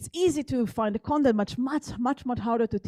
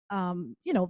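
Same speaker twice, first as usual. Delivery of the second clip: ordinary talk, nothing marked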